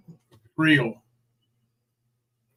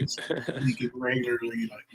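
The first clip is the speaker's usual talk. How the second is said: ordinary talk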